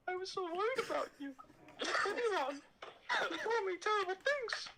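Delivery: high breathy falsetto Padme, swooning; hammed-up high Padme voice. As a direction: squeal her name in a high falsetto. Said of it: "my Padme is unmatched".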